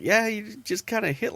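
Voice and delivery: high strained reply; high tight mock pain; high wincing voice. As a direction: answer through a little wince. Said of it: "she's like".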